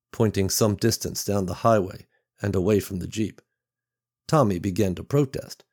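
ordinary delivery

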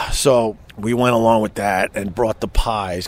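normal talking voice

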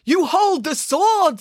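slow solemn herald; solemn man. A narrator's reading. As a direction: announce it gravely. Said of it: "said the man".

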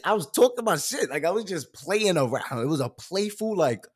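usual speaking voice

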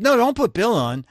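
high, loud alarmed no; panicked protest. Retold or imitated. To editor Nick, begging him not to get the boss, panicked protest.

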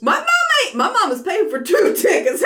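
high, loud mock outburst; mock indignant protest. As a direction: protest it loudly, pitch jumping up. Acts out protest over tickets.

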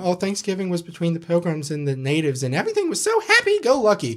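high, fast sing-song naive voice; mock cheerful. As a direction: parrot it like a cheerful schoolkid. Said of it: "we grew up in school saying".

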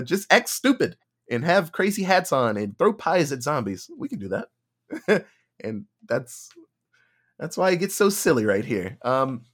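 ordinary talk, nothing marked

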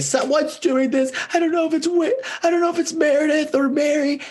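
very high frantic mock voice; imitating Jen. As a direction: squeak it fast, paranoid. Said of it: "and she's like".

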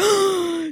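big acted intake of breath; astonished gasp. Woman recognising her old teacher's name, mock astonished gasp. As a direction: gasp as if stunned by the news. Stranger learns who the mother is.

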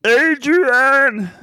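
loud, high yelled name; Stallone yell. Retold or imitated. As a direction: call out the name like Rocky. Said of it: "he's always like".

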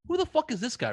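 high, indignant disbelief; incredulous. Retold or imitated. Retelling his first reaction to the rude father-in-law, high incredulous disbelief. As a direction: react like you can't believe his nerve.

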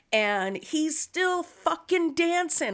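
ordinary talk, nothing marked

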